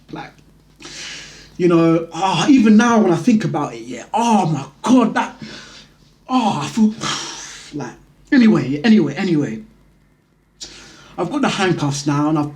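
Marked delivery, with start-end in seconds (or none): none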